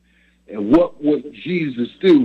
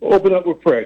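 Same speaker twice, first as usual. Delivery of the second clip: normal talking voice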